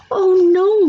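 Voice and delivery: put-on mother hearing her baby's teeth are gone; alarmed cry. A narrator's reading. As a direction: gasp it out in alarm. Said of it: "my mother cried".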